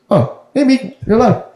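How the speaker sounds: high, airy gentle giant voice; Korg voice. Retold or imitated. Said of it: "one of the best parts is like".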